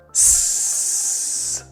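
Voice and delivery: long unvoiced S hiss; whispered S. Shown as a demonstration. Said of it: "say S".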